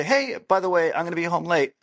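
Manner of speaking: friendly, casual. Shown as a demonstration. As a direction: make it a breezy heads-up. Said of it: "Very different meaning".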